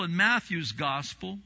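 ordinary delivery

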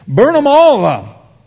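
high, loud, slow hammed-up rage; mock furious cry. Pastor mocking believers who want fire from heaven, furious. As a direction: call down fire in mock fury.

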